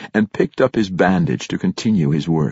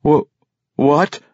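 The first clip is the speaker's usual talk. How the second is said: stammering; 7 semitones higher than usual. A narrator's reading. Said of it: "Brunner stammered".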